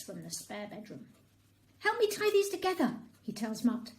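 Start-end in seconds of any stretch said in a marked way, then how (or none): 1.8-3.0 quick, higher urgent ask; out of breath eager cat; hurried request. A narrator's reading. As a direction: rush your friend to help. Portly urging Mutt.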